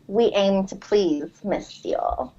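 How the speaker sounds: slow, soft, hushed seducer. Acted. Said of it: "my favorite line will always be".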